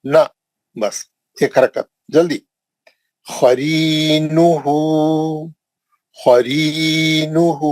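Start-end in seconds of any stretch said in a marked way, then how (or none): 3.3-7.7 slow, drawn-out Quranic recitation. Shown as a demonstration. Teacher recites word slowly for class. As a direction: chant each syllable slowly for students. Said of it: "क्या है?".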